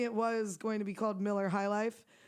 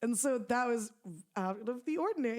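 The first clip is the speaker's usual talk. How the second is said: ordinary talk, nothing marked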